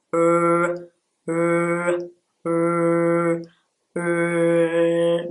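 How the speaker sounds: R as a long, steady hummed "rrr", tongue rolled back; word-initial English R. Shown as a demonstration. Teacher showing Brazilian learners the English R, held long. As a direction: hold the R in one sustained even sound. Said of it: "R at the beginning of the word, pay attention".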